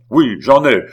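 very loud, abrupt admission; angry retort. A narrator's reading. Beausire owns up to his temper, angry. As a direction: cut in with a shouted retort.

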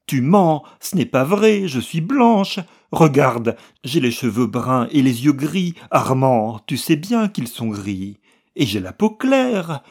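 frantic denial. A narrator's reading. Wife denying her husband's accusation, frantic high protest. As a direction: deny it with rising panic.

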